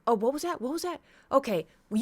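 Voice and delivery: imitating the neighbor challenging him, high and outraged; mock outraged neighbor. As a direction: pounce on his words in disbelief. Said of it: "she goes".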